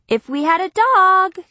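put-on slow, loud whining kid; whiny little girl. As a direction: drag it out like a sulky child. Kitty's repeated complaint.

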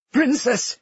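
high, hurt falling cry; a pained appeal, pitched well up. A narrator's reading. Hurt Enzo appeals to Cleo, pained. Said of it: "his voice pained".

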